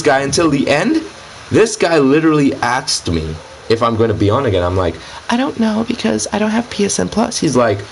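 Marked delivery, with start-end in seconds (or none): 5.3-7.4 high, put-on girl voice. Shown as a demonstration. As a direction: play the fake girl, pitched way up. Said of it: "I said that in my girl voice".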